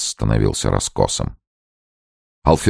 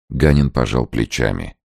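usual speaking voice

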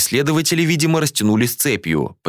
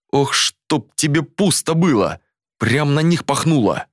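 higher, slow alarmed outburst; 6 semitones higher than usual. A narrator's reading. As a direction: cry out in alarm. Alarmed as wind shifts.

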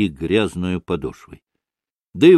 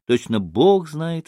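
ordinary delivery

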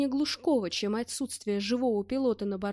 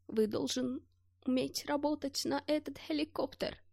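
put-on old soldier with foreign accent, softer, slow and higher; colourless voice; 5 semitones higher, 7 dB quieter, slower than usual. A narrator's reading. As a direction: murmur in despair like a broken old foreigner. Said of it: "стал повторять бесцветным голосом". (бесцветный голос старого солдата-иностранца, тише и медленнее)

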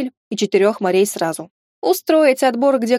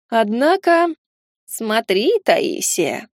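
зловещий голос; much slower than usual. A narrator's reading. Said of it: "зловещий голос сущности".

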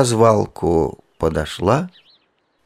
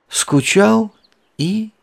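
much higher, drawn-out bored echo; 13 semitones higher, slower than usual. A narrator's reading. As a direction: sigh it up high like a bored toy. Echoing the hippo's boredom.

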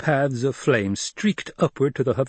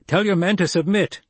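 higher, louder order; commanded; 8 semitones higher, 3 dB louder than usual. Acted. Young leader ordering Gurney, commanding. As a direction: give a firm order to be obeyed. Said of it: "Paul commanded".

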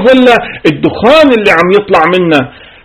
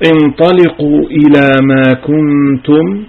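slow chanted Quranic recitation; ترتيل قرآني بطيء; 2 semitones lower, slower than usual. Acted. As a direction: intone the verse slowly like tajweed. Quotes Quran verse in recitation.

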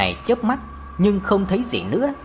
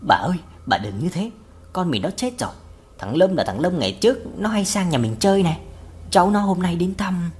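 usual speaking voice

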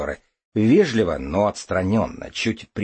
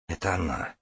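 hushed, softer voice of the heart; quiet inner voice; 2 semitones lower, 8 dB quieter than usual. Acted. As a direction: say it softly, as the heart speaking. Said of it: "говорит сердце".

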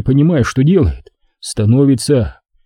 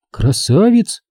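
put-on higher girlish voice, rising question; doubtful echo; 5 semitones higher, slower than usual. A narrator's reading. Girl echoes him with doubt.

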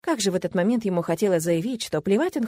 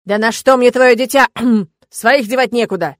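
much louder gruff bark; barking shout; 4 semitones higher, 9 dB louder than usual. Acted. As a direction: bark it right in his face. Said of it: "и гаркнул в лицо демона".

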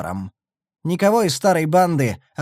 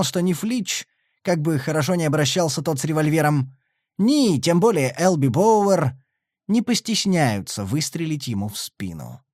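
plain reading voice

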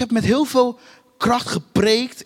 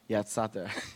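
usual speaking voice

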